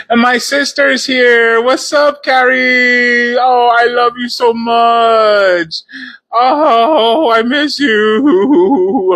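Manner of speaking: high, drawn-out loving greeting; excited greeting. Real, not acted. Greeting her sister on the live stream, high and gushing. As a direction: gush over your sister joining the stream.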